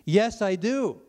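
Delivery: high, loud defiant answer; retold yell back. Prisoner answers before two cell blocks, retold yell back. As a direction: call it out for the whole block. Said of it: "I yelled back in front".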